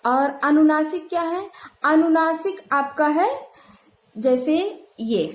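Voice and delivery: normal talking voice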